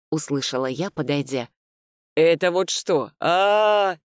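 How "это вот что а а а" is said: loud, jeering mimicry with drawn-out wail; Алёна, mocked. A narrator's reading. Father mocks his daughter's panic, jeering imitation. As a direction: mimic a silly shriek, mildly hammed-up. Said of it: "передразнил он Алёну".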